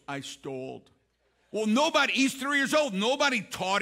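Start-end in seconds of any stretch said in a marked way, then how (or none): 0.1-0.9 put-on small, low, hushed child's confession; mock-guilty murmur, much softer and lower; sheepish confession. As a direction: imitate a toddler owning up, very softly. Toddler confesses to father, retold.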